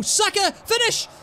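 high yell for him to finish; real excitement; excited urging. Real, not acted. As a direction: call for the finish.

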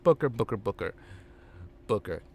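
calm him down, trailing off; Bischoff halting. Acted. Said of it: "Bischoff's like".

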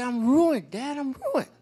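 high-pitched mock despair of a little girl; melodramatic daughter. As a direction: wail it like a child whose life is over, hammed-up. Retold daughter's melodrama over bad grade.

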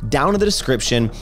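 high-pitched, animated announcer sign-off; podcast host outro. Acted. Host signing off to clip viewers, animated announcer style. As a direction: sell the episode in a lilting, high presenter voice.

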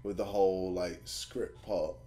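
low, soft, trailing mumbles; quietly deflated, giving up; demoralized. Real, not acted. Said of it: "I'm just so demoralized".

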